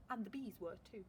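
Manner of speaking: usual speaking voice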